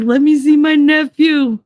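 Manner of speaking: high, slow, hammed-up excitement; melodramatic demand. Brother demanding to see his new nephew, mock melodramatic. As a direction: imitate an over-eager uncle, stretched out. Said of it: "he was so melodramatic about it".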